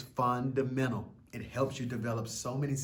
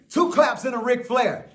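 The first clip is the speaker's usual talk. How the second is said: higher and louder, like a hype man; hype call; 11 semitones higher, 11 dB louder than usual. Shown as a demonstration. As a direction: pump up the crowd with the cue. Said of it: "Are you ready?".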